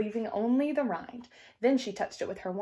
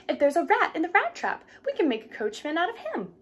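put-on lightly raised, eager young girl; a hint of a bright young heroine, higher; young girl keen to help, raised pitch; Cinderella's voice; 6 semitones higher than usual. A narrator's reading. Cinderella speaks in the tale.

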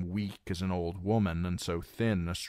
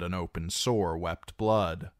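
usual speaking voice

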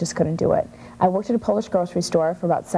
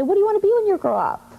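put-on higher, faster grown-up voice asking a kid; adult asking child; 13 semitones higher, faster than usual. Adults asking little girl her dream, higher and faster. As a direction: lightly play a grown-up quizzing a little girl.

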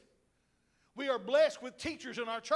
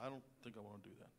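mumbled reluctance; 18 dB quieter, much faster than usual. Retold or imitated. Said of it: "Caleb was like".

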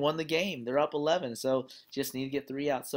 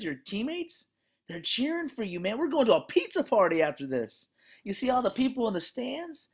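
high, lively pep talk from dad on the mound; a father pumping up his son, pitched up; encouraging coach dad; 10 semitones higher, 5 dB louder than usual. Retold or imitated. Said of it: "And he goes".

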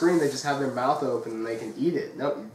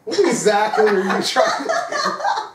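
laughing confused question; 8 semitones higher, 6 dB louder, slower than usual. Retold or imitated. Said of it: "and I'm like".

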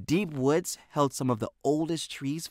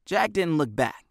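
plain reading voice

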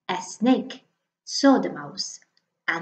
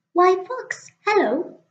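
much higher mouse, bright and quick; little mouse voice; 10 semitones higher than usual. Acted. The mouse announcing Snake, high little voice. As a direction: pitch it up like a tiny mouse.

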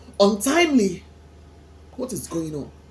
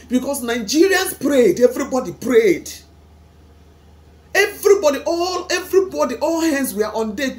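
louder and higher, pleading protest; grieving raised voice; anguished outcry; 4 semitones higher, 7 dB louder than usual. Real, not acted. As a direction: cry it out to heaven, rising. Anguished complaint to God.

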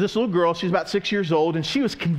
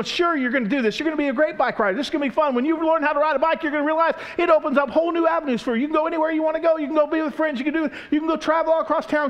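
high-pitched, rushed pep talk; eager encouragement; 11 semitones higher, faster than usual. Acted. Dad running behind his daughter, eager coaxing. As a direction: play a dad talking up bike riding.

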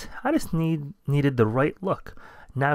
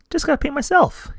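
high squeaky mouse voice; 4 dB louder than usual. Acted. Mouse character eager.

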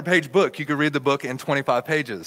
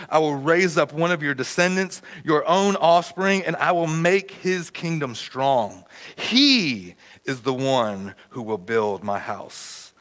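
scripture reading; 4 semitones lower than usual. Acted. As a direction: proclaim God's promise deliberately. Reads scripture aloud.

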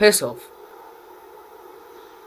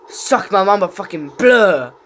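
usual speaking voice

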